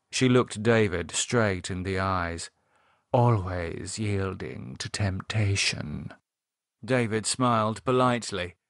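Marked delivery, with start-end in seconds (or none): 3.1-6.1 slow, drawn-out suggestive purr; seductive. Acted. Cynthia looking David straight in the eyes, seductive. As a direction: linger on each word suggestively.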